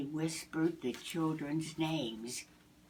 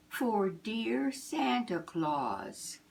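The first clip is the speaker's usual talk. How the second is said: slow, higher note reading; 5 semitones higher, much slower than usual. Acted. Narrator reading the children's note, slow. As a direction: read the note tenderly.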